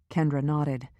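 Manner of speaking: plain reading voice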